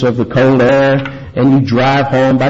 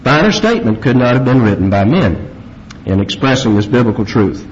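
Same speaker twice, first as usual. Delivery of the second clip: ordinary talk, nothing marked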